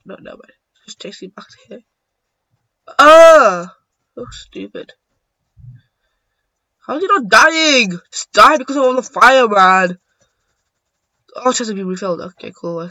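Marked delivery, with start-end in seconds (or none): 3.0-3.8 high, loud frustrated "ah"; loud cry. Real, not acted. Player annoyed by the game, loud cry. 6.9-10.0 loud, high-pitched disbelief; exasperated shouting. Real, not acted. Player annoyed the opponent survives, exasperated shouting.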